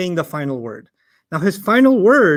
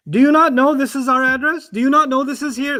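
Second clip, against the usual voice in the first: higher, stressing "not" in "Do you not know"; critical disbelief; 6 semitones higher than usual. Shown as a demonstration. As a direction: scold someone pretending ignorance. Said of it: "much more critical way of saying".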